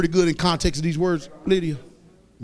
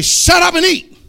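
higher, louder barked command; stern order; 9 semitones higher, 5 dB louder than usual. Retold or imitated. Preacher voicing someone ordering the picky eater, loud. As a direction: snap it like a fed-up parent. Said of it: "and say".

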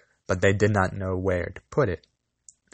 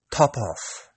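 coolly; 4 semitones higher than usual. Acted. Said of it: "said the cat coolly".

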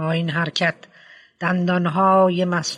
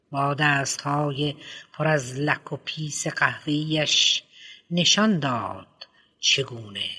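ordinary delivery

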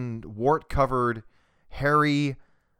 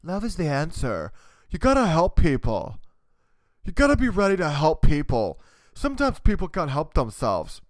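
higher, put-on bleeding-heart voice; mock do-gooder; 4 semitones higher, 4 dB louder than usual. Ranting podcaster parodies those who want to help people, high put-on voice. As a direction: preach in a high mocking voice, louder in the middle.

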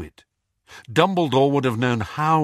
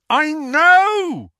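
much higher, louder shout; bellowing; 9 dB louder than usual. A narrator's reading. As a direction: bellow it in frustration. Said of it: "Harry bellowed".

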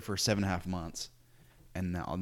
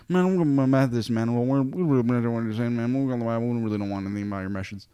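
rural Iowa local; 3 semitones higher, 6 dB louder, faster than usual. Retold or imitated. Methodist householder turning missionaries away in a thick rural Iowa accent.

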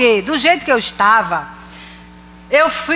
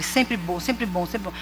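hushed, fading repetition; soft repetition; 6 semitones lower, 11 dB quieter than usual. Acted. Preacher softly repeating God's goodness to herself. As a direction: murmur it like a refrain.

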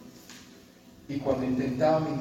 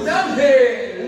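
much louder and higher, drawn out slowly; loud exclamation; 7 semitones higher, 10 dB louder, much slower than usual. Real, not acted. Preacher announcing the angel to the church, loud and slow. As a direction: call it out slow and big.

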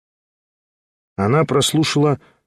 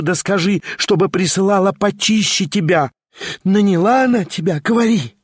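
higher, mocking madwoman taunting; triumphant madwoman; 7 semitones higher than usual. A narrator's reading. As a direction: gloat and sneer at the impostor.

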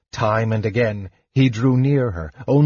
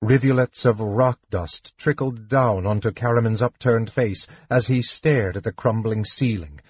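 usual speaking voice

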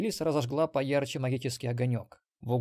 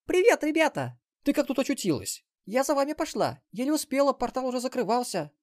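put-on much higher bright girl voice; young girl; 12 semitones higher, 6 dB louder than usual. Granddaughter voiced by narrator.

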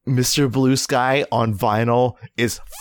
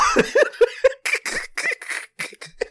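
long high laugh, tailing off; high-pitched helpless laughing fit; hard laughter. Real, not acted. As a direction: crack up and let it run out. Laughing at the failed accent.

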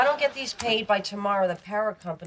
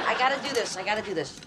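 ordinary talk, nothing marked

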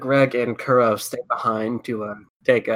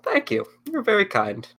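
put-on sweet old lady; 9 semitones higher than usual. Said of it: "I don't do a very good old lady voice".

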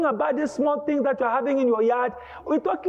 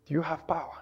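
lower quiet question; 7 semitones lower, 7 dB quieter, faster than usual. Acted. Repeated even lower.

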